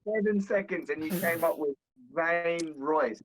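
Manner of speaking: ordinary delivery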